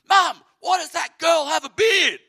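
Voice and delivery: put-on high, loud blurting kid voice; loud child. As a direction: blurt it out like a nosy little kid in a shop. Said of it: "he's like".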